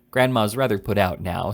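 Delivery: usual speaking voice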